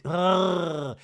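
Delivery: long, drawn-out ugh; disgusted groan. Real, not acted. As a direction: groan with revulsion. Disgust at child's report.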